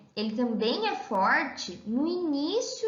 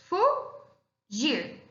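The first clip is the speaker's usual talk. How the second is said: "fugir" as fu, long pause, gir; slow syllable-by-syllable "fugir"; split into syllables; 3 semitones higher than usual. Shown as a demonstration. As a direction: break "fugir" slowly into its two syllables. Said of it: "na hora da separação das sílabas".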